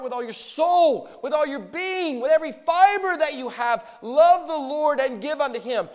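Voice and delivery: high, loud impassioned preaching; preacher driving the point home to the congregation, loud and high; full-force preaching. Acted. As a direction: lift the congregation with fervour.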